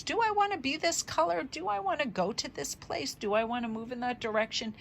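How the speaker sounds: high, musing little creature voice; playful high-pitched animal deliberation; pondering chameleon. Pretend. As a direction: weigh each option, starting high and easing down. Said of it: "It's like they're assessing things".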